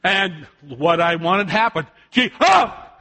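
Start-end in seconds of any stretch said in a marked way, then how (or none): 2.4-3.0 startled yelp. Retold or imitated. Startled toddler cries out.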